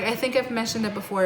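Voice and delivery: ordinary talk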